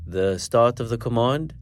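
usual speaking voice

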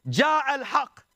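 high, slow, drawn-out mock cry; retold scream. Abu Sufyan cries out to all of Mecca, mock shouting. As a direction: call it out like a man shouting through the streets. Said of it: "He's screaming at the top of the".

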